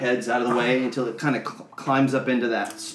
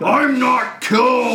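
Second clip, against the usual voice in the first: slow, higher, louder battered giant head; 10 semitones higher, 6 dB louder, slower than usual. Acted. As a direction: drag it out as the stubborn giant head. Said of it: "the giant goes".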